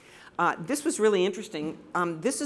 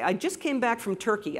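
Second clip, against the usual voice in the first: normal talking voice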